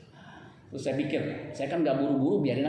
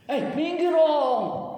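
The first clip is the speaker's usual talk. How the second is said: high, slow shout to get out of the way; mild honking yell; 11 semitones higher, 6 dB louder, much slower than usual. Retold or imitated. Pastor yelling at a wrong-way driver to move over. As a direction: stretch it out loud and high. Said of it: "Itu masih saya halus".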